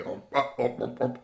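muffled, wordless attempts to talk; mouth full. Fox caught with bird in his mouth, muffled grunts. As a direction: try talking round a mouthful. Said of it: "He couldn't talk because he had the bird in his mouth".

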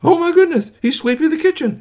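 very high, loud astonished exclaim; amazed gasp. Acted. Parents watching the robot sweep, astonished and very high. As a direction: squeal in disbelief.

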